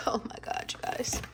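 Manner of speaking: breathy awed whisper over the box; excited whisper. Real, not acted. To her viewers while unboxing, an awed whisper. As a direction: whisper it, almost laughing at how cute it is.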